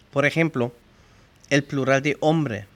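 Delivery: ordinary talk, nothing marked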